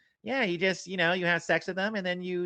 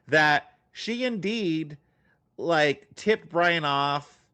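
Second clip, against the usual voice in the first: slow and deliberate; much slower than usual. Real, not acted. Slow, accusing conclusion.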